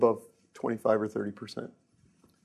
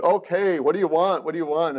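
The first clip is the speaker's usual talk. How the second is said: put-on New York accent; 5 semitones higher, 3 dB louder than usual. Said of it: "in a real thick New York accent".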